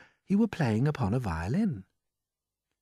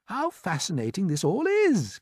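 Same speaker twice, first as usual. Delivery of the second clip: put-on higher, eager boy's exclamation; fascinated cry; 7 semitones higher than usual. A narrator's reading. James marvelling at the grasshopper's lesson, crying out. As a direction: exclaim with rising wonder. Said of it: "cried James".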